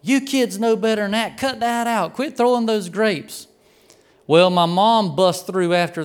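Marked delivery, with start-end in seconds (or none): 0.0-3.5 mock yelling. Aunts to kids throwing grapes from the porch, mock yelling. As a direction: imitate aunts hollering from the porch. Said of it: "They're just yelling at us".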